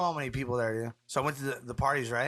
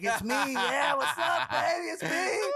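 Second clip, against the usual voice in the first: cocky greeting; 12 semitones higher, slower than usual. Retold or imitated. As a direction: show off to the star. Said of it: "And I'm like".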